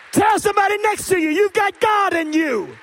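loud, high preaching climax; full-throated preacher rising to hallelujah; preaching at full force. Acted. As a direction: lift the room like a preacher.

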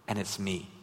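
hushed, tender divine voice; God speaking gently. Retold or imitated. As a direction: speak it quietly like a gentle invitation. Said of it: "And God's saying".

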